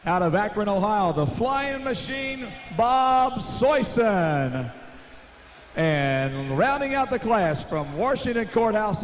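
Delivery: usual speaking voice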